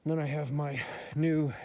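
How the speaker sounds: usual speaking voice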